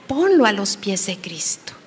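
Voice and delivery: ordinary delivery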